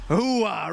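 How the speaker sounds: loud barked hooah, high; loud gung-ho soldier. Pretend. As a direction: Pacino style war cry. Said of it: "that's Al Pacino, maybe?".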